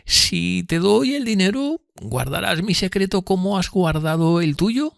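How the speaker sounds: high, slow, drawn-out character voice; earnest Miss Havisham. Acted. Miss Havisham asking Pip for secrecy, high and slow. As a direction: ask slowly and earnestly, pitched well up.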